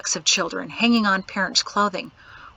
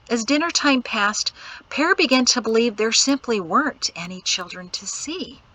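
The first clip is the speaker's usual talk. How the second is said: usual speaking voice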